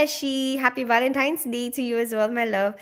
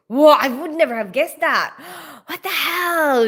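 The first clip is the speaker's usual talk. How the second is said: acted amazed gasp and exclamation; playful, astonished outburst; mind blown; 4 semitones higher, 3 dB louder than usual. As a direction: react to a shock twist ending. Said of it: "blows your mind".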